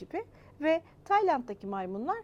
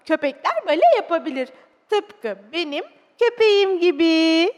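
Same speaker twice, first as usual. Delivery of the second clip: proud; 5 semitones higher, 13 dB louder than usual. Acted. Child telling father that dogs can do ballet, proud. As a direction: proclaim it proudly to finish the tale. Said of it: "dedim gururla".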